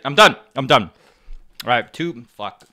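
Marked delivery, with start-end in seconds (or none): 0.1-0.9 loud, fed-up yell; exasperated shout. Real, not acted. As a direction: shout it, you give up. Burning mouth, quitting spicy challenge.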